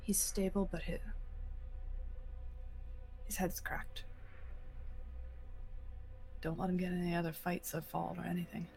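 soft, low and reassuring; quiet reassurance. Acted. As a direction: gently reassure the worried wife.

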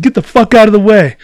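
loud, high-pitched angry yell; imitated yell. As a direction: yell it like a rider shoving through. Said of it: "He yells at me".

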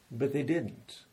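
ordinary talk

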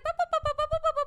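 high-pitched babbled "ba ba ba"; imitated nonstop chatter. Mocking how the two hosts talk on, high babble. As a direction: babble high and fast like endless talking. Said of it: "we're just like".

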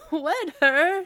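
loud, high incredulous shout. A narrator's reading. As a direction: fling it back in disbelief. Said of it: "shouted Kay".

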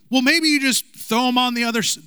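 high teasing suggestion; sarcastic Jesus. Retold or imitated. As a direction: needle them with a knowing lilt. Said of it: "if he's not sarcastic".

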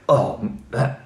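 lost for words. Retold or imitated. Startled intruder caught by the homeowner, mock flustered stammering. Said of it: "he didn't know what to say".

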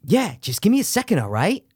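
hammed-up Mark Wahlberg voice; Marky Wahlberg impression. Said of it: "That was my Marky Wahlberg impression".

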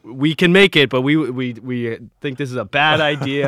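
loud, high mock German brewer accent; hammed-up German brewer scolding. German brewers telling the founders this is not how to make beer, in a loud mock German accent. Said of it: "I can't do a good German accent".

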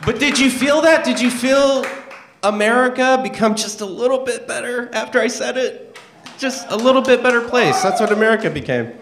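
high, loud, gloating voice; mock triumphant. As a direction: crow about it in a high voice. Mock triumphant after crude poem.

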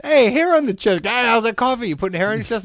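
high, loud, bubbly call across the counter; cheery greeting. Retold or imitated. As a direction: sing out the nickname like a happy barista. Barista greeting him with nickname.

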